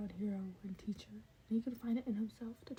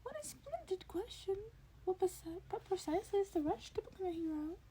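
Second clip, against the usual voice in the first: high, giddy, quick creature voice; much higher and delighted; 11 semitones higher than usual. A narrator's reading. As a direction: bubble over with delight at the question. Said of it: "overcome with a giddy".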